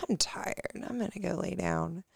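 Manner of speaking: put-on soft whispered sleepy kid; child voice, whispered. Mom voicing her sleepy daughter in a whisper. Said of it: "Rudy will be like".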